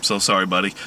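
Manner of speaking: ordinary talk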